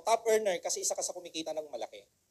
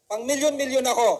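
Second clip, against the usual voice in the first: louder and higher, proud; loud declaration; 4 semitones higher, 6 dB louder than usual. Shown as a demonstration. Speaker urging audience, loud proud affirmation. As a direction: say it out loud and mean it. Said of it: "Sabihin nyo sa sarili nyo".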